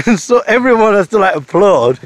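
normal talking voice

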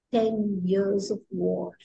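low, slow, drawn-out line; haiku recitation. Shown as a demonstration. Teacher reciting the last line, low and slow. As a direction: let the words sink slowly after a pause.

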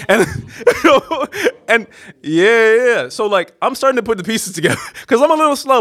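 high-pitched laughing through the words. Real, not acted. Teller amused at his own slowness, laughing. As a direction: tell it while breaking into real laughter.

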